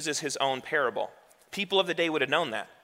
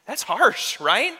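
shocked reader; 7 semitones higher, 4 dB louder, much slower than usual. Retold or imitated. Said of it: "read that line and be like".

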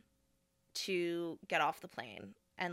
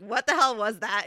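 louder, exasperated outburst; 3 semitones higher, 9 dB louder than usual. Retold or imitated. To the stewardess, exasperated about the scene. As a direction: let it burst out. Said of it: "I was like".

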